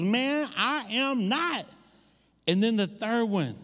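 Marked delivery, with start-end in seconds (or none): 0.0-1.7 high, slow acted protest; emphatic denial. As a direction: protest each word, drawn out and high. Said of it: "And his response was".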